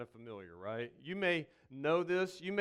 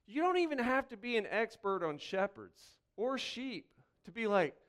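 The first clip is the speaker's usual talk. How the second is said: normal talking voice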